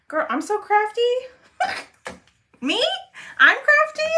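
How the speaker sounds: high-pitched, incredulous questions with a laugh; flattered disbelief. Real, not acted. Crafter answers a viewer calling her crafty, in giddy disbelief. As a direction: squeal it back, as if you can't believe the compliment.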